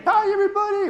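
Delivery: very high puppet voice; tiny cartoon pencil talking; Timmy the pencil voice. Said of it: "Timmy's my little pencil friend".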